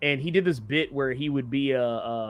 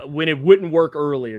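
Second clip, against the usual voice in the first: ordinary delivery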